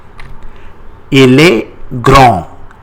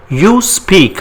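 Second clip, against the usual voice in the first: You speak, pitched up as a cue; teacher prompt; 7 semitones higher than usual. Shown as a demonstration. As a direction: ask the class to translate. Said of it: "How do you say it?".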